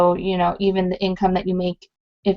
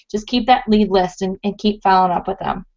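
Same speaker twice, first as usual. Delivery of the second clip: ordinary talk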